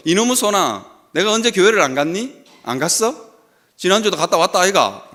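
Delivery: 경상도 사투리로 투박하게; Gyeongsang dialect. Retold or imitated. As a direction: lay on the Gyeongsang accent, 아이가 at the end. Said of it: "경상도 말로".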